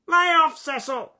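high, loud bird squawk; croaking rook. Acted. Rook tells the snake off in a high loud croak. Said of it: "he croaked".

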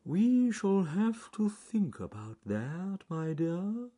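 high, very slow tortoise voice; slow-witted storybook tortoise, high and drawn out; John Slow Tortoise. A narrator's reading. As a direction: ponder aloud, dragging every word. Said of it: "said John Slow Tortoise to his wife".

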